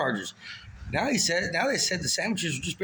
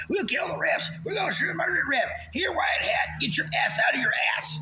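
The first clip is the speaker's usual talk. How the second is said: high, shouted angry fan voice; hammed-up stadium heckling; mock fan shouting; 11 semitones higher than usual. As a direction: yell like a rowdy fan at the refs. Mocking angry fans at game.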